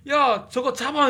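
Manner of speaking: high, loud, slow barked command; shouted order. Retold or imitated. The commander orders the crew to catch the creature, shouting. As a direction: yell it across the room to the crew. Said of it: "이러는데".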